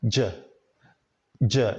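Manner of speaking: short voiced "j" burst; isolated voiced J. Shown as a demonstration. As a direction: say "j" alone as a quick voiced sound. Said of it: "And the last sound here".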